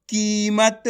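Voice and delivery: कीमत with the first vowel held long; drawn-out reading. Shown as a demonstration. Teacher joins the letters into a word, drawn-out कीमत. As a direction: stretch the की in कीमत. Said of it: "आपको खींच के पढ़ना है".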